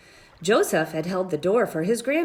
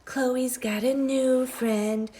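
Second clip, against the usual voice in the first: taunting whisper; 5 semitones higher, slower than usual. A narrator's reading. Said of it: "Andrew whispered".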